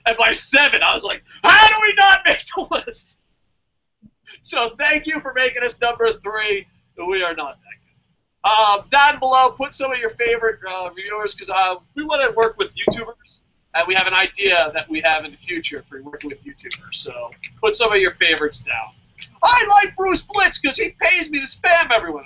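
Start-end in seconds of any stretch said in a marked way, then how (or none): 1.4-3.2 high, loud retold shout; emotional outburst. Podcaster recapping his roller coaster at number seven, indignant shout. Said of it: "it was an emotional roller coaster". 19.4-22.2 high-pitched blurted plug; mock endorsement. Podcaster jokingly plugging a YouTuber who pays him, mock endorsement.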